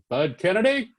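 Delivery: name called out with a rising end; calling out sharply. Retold or imitated. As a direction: imitate a brusque boss on the phone. Editor calls back, startling him.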